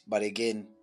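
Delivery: usual speaking voice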